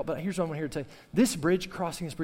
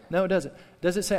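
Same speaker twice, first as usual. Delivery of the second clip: normal talking voice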